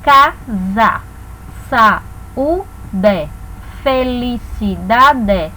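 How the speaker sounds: "Casa, saúde, felicidade" broken slowly into separate syllables. Shown as a demonstration. Teacher giving examples of syllables, slow syllable-by-syllable delivery. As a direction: sound out each syllable of "Casa, saúde, felicidade" with small gaps. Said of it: "Vamos aos exemplos".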